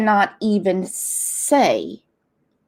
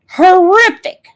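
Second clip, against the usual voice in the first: horrific thrown out much higher and loud; emphatic stress; 9 semitones higher, 8 dB louder than usual. Real, not acted. Stressing the child's horrific death, high and loud.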